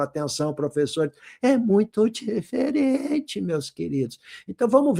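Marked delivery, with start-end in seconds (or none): 1.4-3.4 DIFERENTE stretched out high; slow, high, hammed-up "muito diferente"; exaggerated emphasis. Shown as a demonstration. Teacher stressing difference to pupils.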